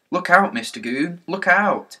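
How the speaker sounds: high, loud warning shout; urgent warning. A narrator's reading. Children warn the policeman of a bicycle, alarmed shout.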